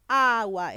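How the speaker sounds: "agua" with a loud, long opening A; stress on first syllable. Shown as a demonstration. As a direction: hit the first A of "agua" hard. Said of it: "es la sílaba tónica". ("agua" recalcando fuerte la primera A)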